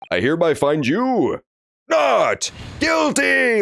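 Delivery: drawn-out, high cry of not guilty; stretched, triumphant courtroom announcement; verdict proclamation. Acted.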